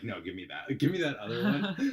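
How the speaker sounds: eager laughing high "give me that other one"; pushy and giddy as if snatching the card; eager grab. Retold or imitated. At the restaurant, snatching the card back, eager and laughing.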